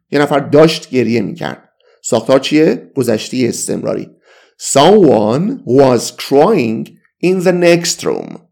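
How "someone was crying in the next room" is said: very slow, each word spaced out; slow teaching demo; slow model sentence. Shown as a demonstration. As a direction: read it word by word for learners. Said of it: "teacher repeats model sentence".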